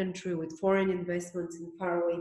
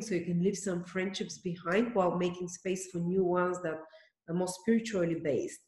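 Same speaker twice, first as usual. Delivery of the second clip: ordinary delivery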